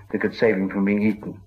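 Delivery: plain reading voice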